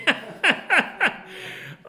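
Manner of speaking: high, loud, long burst of laughter; hearty laugh. Real, not acted. Preacher amused by Israel's fear of dying, hearty laugh. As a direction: crack up helplessly.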